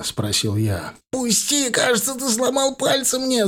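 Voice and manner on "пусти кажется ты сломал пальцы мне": much higher, whining yelp; pained cry. A narrator's reading. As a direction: beg him to let go, voice up high. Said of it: "закричал лысый".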